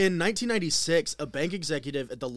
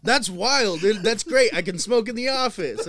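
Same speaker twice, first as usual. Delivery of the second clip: mock thrilled; 5 semitones higher, 7 dB louder than usual. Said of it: "The F B I is like".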